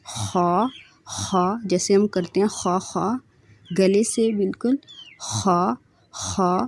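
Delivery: خا said thick and raspy from the throat; a full, heavy خا; heavy letter. Shown as a demonstration. Teacher demonstrating heavy خا to students. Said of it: "یہ بھی موٹا پڑھا جانے والا حرف ہے".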